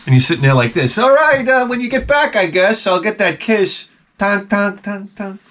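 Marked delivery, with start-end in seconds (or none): 1.0-3.9 hammed-up hopeful husband let down; sheepish mock disappointment. Voicing himself left waiting, sheepish. 4.2-5.4 high, hollow mock knocking sound; cartoon thud sound effect; imitated tonk noise. Imitated sound punctuating the joke.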